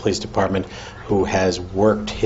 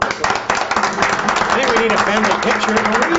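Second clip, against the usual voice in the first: cheerful calling out; 11 semitones higher than usual. Real, not acted. Calling family up for photo.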